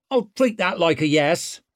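swaggering rogue taunting; brash young woman. A narrator's reading. Ricker voice.

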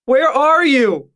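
very high, drawn-out cry; desperate shout. A narrator's reading. Injured June shouts through the cave passages, high drawn-out shout. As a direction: shout slowly as if across a great distance. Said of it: "A woman's voice shouted".

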